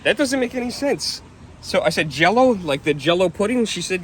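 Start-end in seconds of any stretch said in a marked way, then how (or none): 2.1-3.6 rising high, puzzled "Jello?"; retold confused double-check; puzzled question. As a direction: ask it in mild disbelief, pitch going up.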